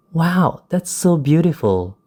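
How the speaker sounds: high-pitched, delighted exclamation; happy excitement. Shown as a demonstration. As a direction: show delight through a lifted intonation. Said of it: "illustrates a feeling of happiness or excitement".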